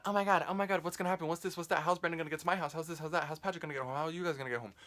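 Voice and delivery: high, frantic imitation of a fretting grandma; grandma freaking out. Grandma fretting to the family about getting home, high frantic mimicry. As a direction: mimic grandma spiralling with worried questions. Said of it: "going to everyone like".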